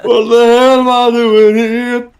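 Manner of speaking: dramatic. Acted. Dramatic reread of the line.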